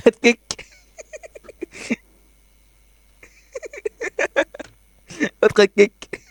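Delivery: high laughing taunt; gleeful taunt. Real, not acted. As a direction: gloat over the kill. Taunting a downed enemy. (تريقة وضحك)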